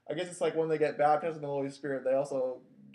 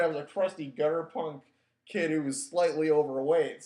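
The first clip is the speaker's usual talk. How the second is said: normal talking voice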